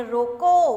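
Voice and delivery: loud, drawn-out रोको left hanging before the pause; रोको as a loud command standing on its own; pause after रोको. Shown as a demonstration. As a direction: stretch out रोको loudly, then stop. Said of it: "उसके बाद pause दिया".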